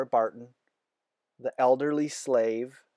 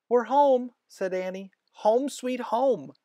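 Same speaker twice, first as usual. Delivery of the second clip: much higher and louder, thrilled; overjoyed little girl voice; excited; 11 semitones higher, 4 dB louder than usual. A narrator's reading. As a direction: burst out with joy at being home. Annie excited to be home.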